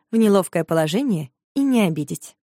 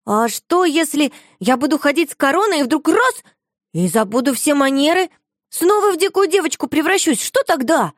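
worried; 8 semitones higher, 4 dB louder than usual. A narrator's reading. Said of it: "испугалась".

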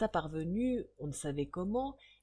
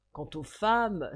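ordinary delivery

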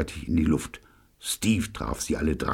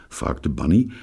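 usual speaking voice

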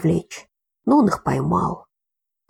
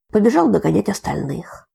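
ordinary delivery, nothing marked

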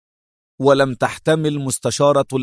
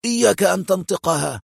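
sharp warning at raised pitch; sharp interruption; 5 semitones higher than usual. A narrator's reading. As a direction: cut in sharply to forbid it. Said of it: "قاطعه السوري في حدة".

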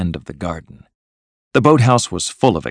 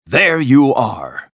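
slow, higher, proud exclamation; triumphant cry; 5 semitones higher, 3 dB louder, much slower than usual. A narrator's reading. Toad unveils his caravan to his friends, triumphant cry. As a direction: present it with a drawn-out flourish. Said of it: "cried the Toad".

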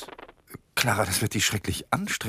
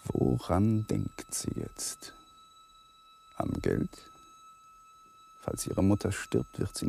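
geflüstert; 3 dB quieter, slower than usual. A narrator's reading. Innerer Monolog, leise.

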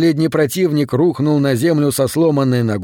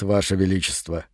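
low, soft creaking tree voice; creaking wooden voice; 6 semitones lower, 7 dB quieter than usual. A narrator's reading. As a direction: answer calmly like cracking oak. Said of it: "голосом, похожим на треск переламываемой дубовой ветви".

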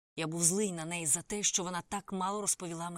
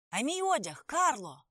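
loud, higher call after a leaving taxi; 7 semitones higher, 7 dB louder, slower than usual. A narrator's reading. Narrator shouting after Karla's taxi.

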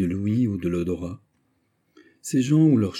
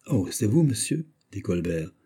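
plain reading voice

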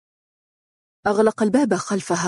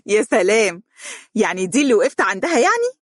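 laughing; 7 semitones higher, faster than usual. A narrator's reading. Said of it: "ضحكت وهي تقول".

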